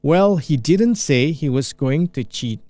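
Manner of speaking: slow, deliberate teacher read; very slow model line; slowed down demo. Shown as a demonstration. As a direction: stretch every word for learners. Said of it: "saya pelanin".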